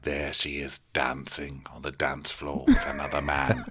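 low, soft mock dramatic sulk; jealous chef. Chef watching her dance, in a low moping mock lament. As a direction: brood like a wounded lover, voice dropped low. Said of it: "you know, like".

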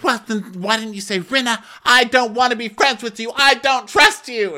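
hammed-up pleading; mock high demand. Rinna questioning Garcelle, high mock plea. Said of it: "She goes".

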